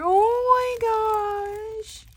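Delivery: very slow, high, loud squeal; delighted squeal. Real, not acted. Crafter spotting a sock monkey, long squeal.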